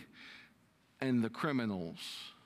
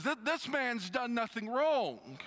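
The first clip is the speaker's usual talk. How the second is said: sudden realisation; 8 semitones higher than usual. Retold or imitated. Criminal suddenly defending Jesus, raised voice. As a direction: blurt it as it dawns on him. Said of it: "all of a sudden he goes".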